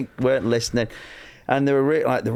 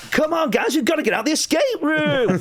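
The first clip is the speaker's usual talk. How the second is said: very high, urgent mock rallying; hammed-up escape room host cheering the team on; game host pep. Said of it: "they do the Crystal Maze".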